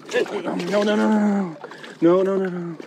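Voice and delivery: desperate cry as the catch gets away; panicked no. Real, not acted. Angler begging the fish not to escape, panicked and loud.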